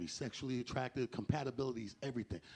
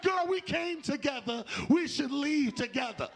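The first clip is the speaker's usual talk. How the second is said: put-on high, slow, laughing plea from a girlfriend; high pleading girlfriend; 10 semitones higher, 4 dB louder, slower than usual. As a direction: beg your friend to leave with you, high and drawn out. Friend pleading to leave together.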